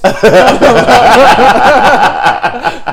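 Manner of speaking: high, very loud burst of laughing; loud laughter. Real, not acted. Cracking up at the sugar tits complaint, loud laughter.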